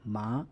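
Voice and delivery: ma changed to a rising second tone. Shown as a demonstration. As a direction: turn the dip into a rise on ma. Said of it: "It can change to the second tone".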